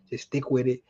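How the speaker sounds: ordinary talk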